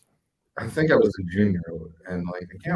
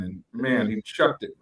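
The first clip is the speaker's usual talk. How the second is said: usual speaking voice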